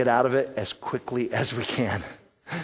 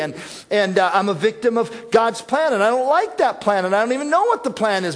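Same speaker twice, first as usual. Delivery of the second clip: frustrated outburst; 7 semitones higher, 5 dB louder, faster than usual. Retold or imitated. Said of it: "that's our spirit in transition".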